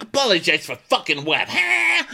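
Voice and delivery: high, indignant outburst; mock pissed off. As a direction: imitate an outraged man, rising to a loud huh. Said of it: "all pissed off".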